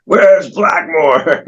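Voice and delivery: mock spooky spirit calling for Blackmore; upset spirit. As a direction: drag it out like an angry spirit from a seance. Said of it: "the spirit was upset".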